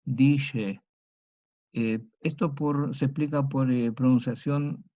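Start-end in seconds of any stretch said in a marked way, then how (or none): 0.0-0.8 dice with a sh sound, DI-she; old Spanish sh. Shown as a demonstration. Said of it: "Y suena así como dice".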